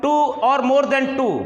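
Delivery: very slow, each word spaced; slow stress. Shown as a demonstration. Teacher stressing a definition slowly to students. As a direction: drag out the phrase for emphasis. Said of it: "जो दो या दो से अधिक शब्दों से".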